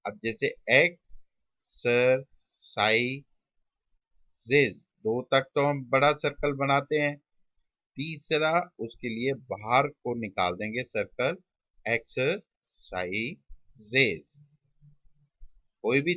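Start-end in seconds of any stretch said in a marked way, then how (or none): 0.7-4.8 "exercises" very slow, syllable by syllable; slow sounding-out. Shown as a demonstration. Teacher marking three sounds for students, slow sounding-out. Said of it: "तीन sounds के लिए". 11.9-14.4 "exercises" slowly, in separated syllables; slow sounding-out. Shown as a demonstration. Teacher dictating while forming the outline, slow sounding-out. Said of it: "तीन sounds के लिए".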